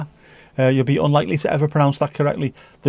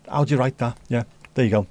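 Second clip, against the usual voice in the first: ordinary talk, nothing marked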